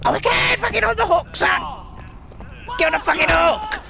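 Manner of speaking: high, strained yell at an enemy; fighting shout. Real, not acted. Player wrestling an enemy onto the hook, shouting.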